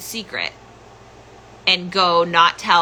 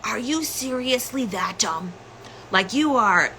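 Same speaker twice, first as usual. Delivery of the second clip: high, held-back imitation of a furious father; retold angry outburst; 4 semitones higher, 4 dB quieter than usual. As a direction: imitate dad losing it, voice pitched up. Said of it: "he just loses it on me".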